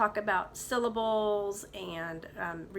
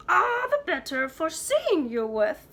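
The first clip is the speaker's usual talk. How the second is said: louder, wobbly disguised wolf; the wolf pretends to be frail grandma; wobbly fake grandma; 8 semitones higher, 8 dB louder than usual. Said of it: "in the same wobbly voice".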